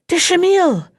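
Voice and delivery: calling up to him. A narrator's reading. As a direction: call his name from above. Calling up from a window.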